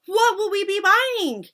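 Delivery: very high, loud screeching chorus; witch chorus. Acted. Witches begging their leader, high screech. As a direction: shriek it like eager witches.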